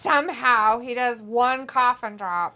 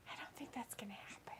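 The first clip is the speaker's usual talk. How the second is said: whisper; 23 dB quieter, faster than usual. Real, not acted. Whispered aside about Chris.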